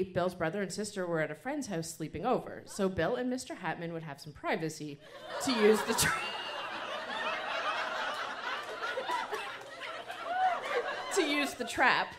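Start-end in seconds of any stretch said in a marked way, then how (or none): 5.4-12.1 breaking into laughter mid-word, then forcing it out; laughing through. Real, not acted. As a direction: fight the giggles to finish the line. Reader cracks up mid-word.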